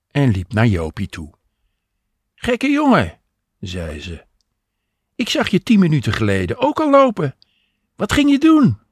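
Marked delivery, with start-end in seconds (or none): 2.3-3.2 high, loud woman calling to her man; raised, high-pitched teasing exclamation; Jopie teasing. A narrator's reading. Jopie greets lost Henk in story. 5.1-8.8 high, loud, quick questioning; high-pitched woman wanting an explanation; Jopie exclaiming. A narrator's reading. Jopie questions Henk in story.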